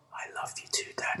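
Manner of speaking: soft sleepy whisper. Retold or imitated. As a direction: whisper it back half asleep. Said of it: "he whispers back".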